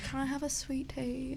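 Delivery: a light mock southern drawl on sweet tea. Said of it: "I used to have a bit of a southern drawl".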